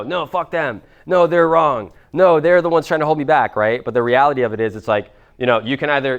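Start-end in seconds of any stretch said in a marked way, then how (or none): 0.0-3.6 louder, higher indignant ego talking back; defensive ego voice. Retold or imitated. Coach voicing a stubborn ego that blames others, mock defiance. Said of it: "your ego".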